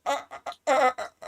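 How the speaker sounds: high, laughing stammer; flustered girlfriend. Retold or imitated. Imitating the girlfriend stammering when roasted, high and laughing. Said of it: "She's gonna be like".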